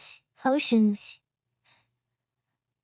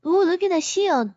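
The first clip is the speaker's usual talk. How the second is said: VTuber fox voice; 6 semitones higher than usual. Acted. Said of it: "It's more of VTuber Fox voice".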